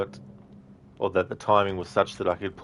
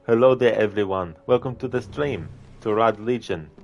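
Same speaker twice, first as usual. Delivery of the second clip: put-on Arnie accent; 3 semitones higher than usual. Mocking Rob's stream intro with an imitated Arnie accent. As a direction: ham up a mock Austrian Arnie voice for the stream greeting. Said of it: "have Arnie's accent by default".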